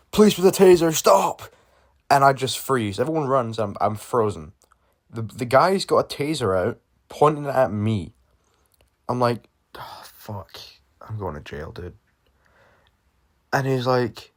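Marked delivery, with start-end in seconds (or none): 0.1-1.4 high, loud police command; officer shouting at a runaway, loud and high. Retold or imitated. Officer calls after fleeing kids. 9.7-12.0 soft dread under the breath; whispered fear facing an officer's taser. Retold or imitated. Frozen before a taser.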